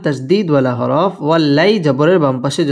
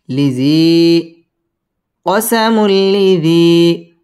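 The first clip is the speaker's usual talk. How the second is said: each sound stretched long; very slow; 3 dB louder, much slower than usual. Shown as a demonstration. Teacher spells it very slowly. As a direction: drag every syllable out.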